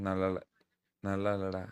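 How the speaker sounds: ordinary talk, nothing marked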